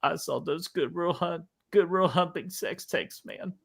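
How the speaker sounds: high, hammed-up weepy actor voice; mock crying. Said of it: "in order to cry".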